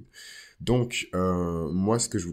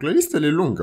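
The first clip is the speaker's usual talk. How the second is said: emphatic; 11 semitones higher, 10 dB louder, faster than usual. Real, not acted. Bragging about her high standards.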